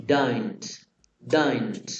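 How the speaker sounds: "don't" said slowly and clearly, as the model pronunciation; pronunciation demo. Shown as a demonstration. Teacher showing students how to say "don't". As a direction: model "don't" for the class to copy. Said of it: "la pronunciación sería así".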